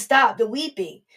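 Jesus calling over the wailing crowd; Jesus commanding. Retold or imitated. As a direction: silence the mourners with authority. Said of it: "He says".

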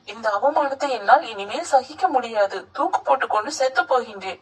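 ordinary delivery, nothing marked

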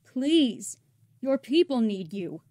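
desperate resistance commander begging; pleading. Acted. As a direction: plead with him to stop. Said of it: "She starts pleading".

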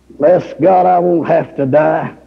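normal talking voice

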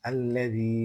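"আল্লাযী" with its last vowel held; one-alif stretch. Shown as a demonstration. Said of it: "এক আলিফ টান হবে". ("আল্লাযী"-র শেষে এক আলিফ টান)

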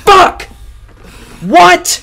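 high, loud shocked shout; startled scream. Real, not acted. Gamer shocked by the game, startled scream. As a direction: yell in shock. Jump scare in horror game.

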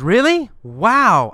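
loud, wowed reaction, first word swooping up high; impressed surprise. Acted. Ex-girlfriend hears who he is dating, impressed surprise. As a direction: act amazed by the news.

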